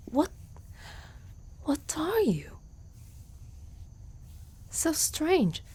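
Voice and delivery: high, breathless whisper of wonder; astonished whisper. Acted. Centaur sees the stranger's rounded ears, astonished whisper. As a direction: hush it out in disbelief.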